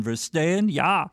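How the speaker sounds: halting phrasebook tourist German; broken German accent. Pretend. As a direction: ask and answer in broken German. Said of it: "in my broken German".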